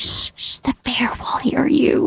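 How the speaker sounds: shushing, then a soft whispered warning; urgent hushing whisper. Retold or imitated. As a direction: hush the child without a sound. Parents hush child during bear.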